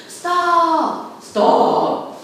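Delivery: high, loud calls to stop; shout. Acted. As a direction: chase after him, yelling. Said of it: "they shouted".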